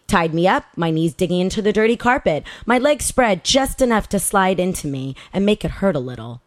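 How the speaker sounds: ordinary delivery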